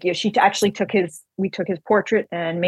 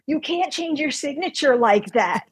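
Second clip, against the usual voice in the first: higher-pitched mock scolding; retold indignant protest; 6 semitones higher than usual. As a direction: imitate scandalized callers telling him off. Retold outraged phone callers scolding.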